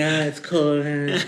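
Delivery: whiny mock grumble about the cold; mock complaining. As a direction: imitate a sulky player moaning.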